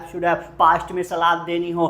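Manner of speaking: ordinary talk, nothing marked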